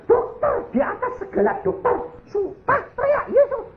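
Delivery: very high-pitched mock screaming; frantic screaming. Wife calling on the doctor above all doctors, frantic screaming. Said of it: "teriak tambah kenceng".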